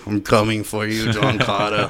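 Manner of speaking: ordinary talk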